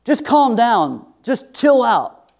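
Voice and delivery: high, slow, condescending calm down; dismissive snap. Retold or imitated. Scene: mocking the useless calm down line, slow and high. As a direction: talk down to someone mid-argument. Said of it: "Has that ever worked for you guys?".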